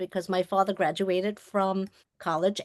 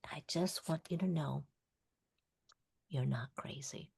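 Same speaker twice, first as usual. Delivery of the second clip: hushed whisper; 4 semitones lower, 12 dB quieter than usual. Retold or imitated. Doctor reassuring a frightened patient, whispered. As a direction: whisper it close and gentle, much softer.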